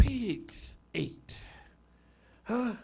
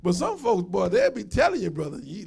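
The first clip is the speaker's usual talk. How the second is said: ordinary delivery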